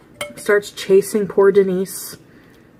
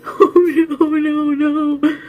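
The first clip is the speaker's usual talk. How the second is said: hammed-up panicked wail; 8 semitones higher, much slower than usual. Mocking the guest refusing to turn around, high panicky wail. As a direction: beg off in fake terror. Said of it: "she's like".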